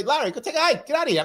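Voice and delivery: high-pitched imitation of someone refusing; mock indignant retort. Listener telling the host off for yelling, mock indignant. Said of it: "If you say".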